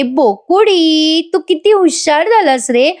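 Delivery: high, long-drawn coo on the name; gushing pet-name praise. Retold or imitated. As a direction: imitate someone fawning over a pet. Acted gushing praise of toy cat.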